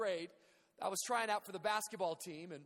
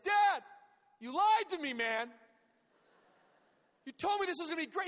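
put-on higher, louder boyish complaint; frustrated son yelling; 8 semitones higher, 6 dB louder than usual. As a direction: son yelling at dad after a loss. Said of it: "Came home, I said".

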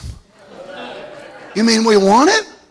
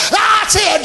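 cheering grandparent; 8 semitones higher than usual. Retold or imitated.

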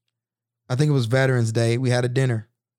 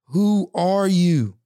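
bewildered question to self; 6 semitones higher, much slower than usual. Real, not acted. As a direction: ask your own reflection, drawn out in disbelief. Staring at himself in mirror.

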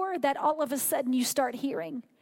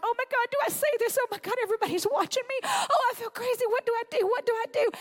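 high, fast, panicky inner voice; hammed-up jittery fretting, much higher; mock panic; 8 semitones higher, faster than usual. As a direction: play the flustered beginner losing their nerve.

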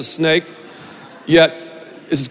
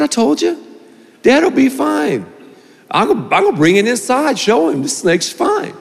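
put-on higher, eager kid bragging; cocky excited boy; 7 semitones higher than usual. Boy boasting to his brother about the snake, higher and eager. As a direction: brag like a nine-year-old showing off.

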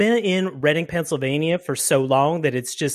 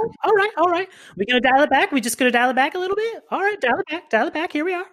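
much higher, sing-out calming phrases; high-pitched placating; 12 semitones higher, faster than usual. Acted. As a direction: dial it back in a very high voice. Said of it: "Can you not do that?".

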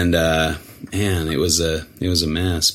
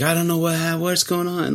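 mock freaking out; 8 semitones higher than usual. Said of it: "he's all freaking out".

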